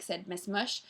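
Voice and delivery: ordinary delivery, nothing marked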